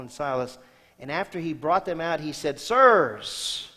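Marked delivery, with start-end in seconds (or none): 2.6-3.7 loud, high plea of Sirs; pleading cry. A narrator's reading. Jailer falls before Paul and begs, loud pleading.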